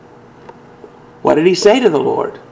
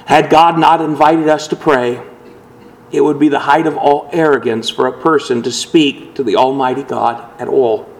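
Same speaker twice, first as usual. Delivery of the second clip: ordinary talk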